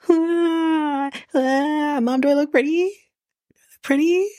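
high-pitched imitation of tearful wailing; mock ugly crying. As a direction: whine it like a hammed-up crybaby. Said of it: "the ugly crying is setting in".

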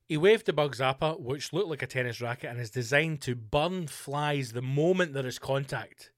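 usual speaking voice

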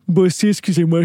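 yawning through the word. Real, not acted. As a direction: talk mid-yawn. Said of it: "je baille". (en bâillant)

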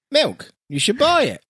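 high, slow silly ad pitch; mock advert voiceover. Podcaster pitching how voiceovers should be, high mock ad voice. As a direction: stretch it out high like a daft milk advert. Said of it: "Some voiceovers should be like".

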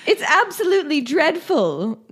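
put-on high, Mrs Doubtfire-like British accent. Co-host quotes dismayed councillor, mock British accent. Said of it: "it's like Missus Doubtfire".

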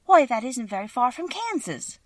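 high-pitched eager surprise; excited cry. A narrator's reading. Dorothy hearing the man is from Omaha, crying out. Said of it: "cried Dorothy".

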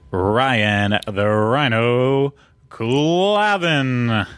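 very slow, stretched-out ring-announcer build; announcer introduction. Pretend. Host introducing his co-host, drawn-out announcer call. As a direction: draw the name out grandly, swelling on the surname.

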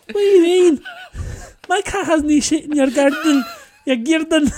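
indignant Scottish neighbour shouting back, high and loud; Scottish accent. Retold or imitated. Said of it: "And the other one's like".

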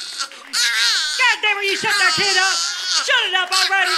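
high, loud furious shout; angry yell. Pretend. Angry parent yelling about kid.

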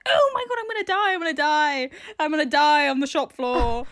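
mock panic. Host retelling her rat wee panic at work, hammed-up terror. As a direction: squeal it like you're doomed. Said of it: "I was like".

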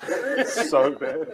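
laughing. Real, not acted. Laughing about his diary.

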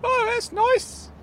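high, very slow sarcastic squeak; high-pitched sarcastic character voice; huffy Claude. Acted. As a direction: drawl it in a high hurt voice.

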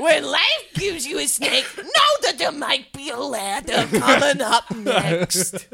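goofy cartoon coach voice; Coach Steve. Sharing a snakes-and-ladders motto, Coach Steve impression. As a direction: play a dopey coach giving advice. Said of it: "mine just sounds like Coach Steve".

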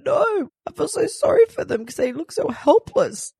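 high half-tearful reply; mock tearful plea. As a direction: answer the doctor with a tearful high voice. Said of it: "I said".

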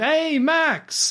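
excited call. Retold or imitated. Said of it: "the woman shouts".

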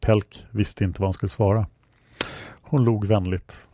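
usual speaking voice

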